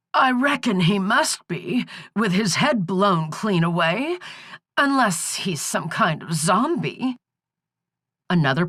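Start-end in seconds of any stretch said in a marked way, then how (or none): 0.1-7.2 put-on high, folksy old hag; croaking old crone. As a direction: drawl it like a backwoods granny. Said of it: "the croaking was starting to hurt her throat".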